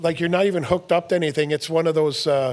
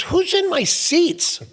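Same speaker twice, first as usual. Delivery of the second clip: higher, slow mock outrage; indignant coach; 11 semitones higher, much slower than usual. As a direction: drag it out like a coach spotting strangers in his seats. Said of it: "he looks over and he goes".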